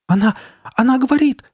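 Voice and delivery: high, loud panicked cry. A narrator's reading. Steve crying out that the head speaks, high alarmed shout. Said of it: "вскричал Стив".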